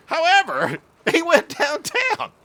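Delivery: very high, loud, laughing mock yell; retold punchline outburst. Whipped brother restarting the joke at bedtime, gleeful outburst.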